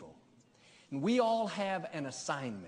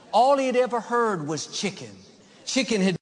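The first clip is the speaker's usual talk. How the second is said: loud, driving preacher's delivery; booming sermon voice; full-force preaching; 10 dB louder than usual. Acted. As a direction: preach it big to the whole congregation.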